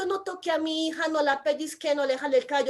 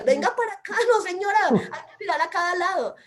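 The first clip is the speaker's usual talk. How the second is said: put-on sharp, high, loud call to a child; snatch her back from the road; alarmed mother yelling; urgent shout; 5 semitones higher, 5 dB louder than usual. Mother yanks child from car.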